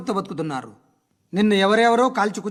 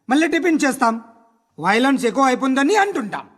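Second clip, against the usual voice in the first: ordinary talk, nothing marked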